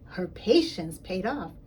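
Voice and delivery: ordinary delivery, nothing marked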